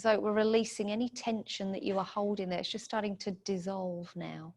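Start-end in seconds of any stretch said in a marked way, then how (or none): none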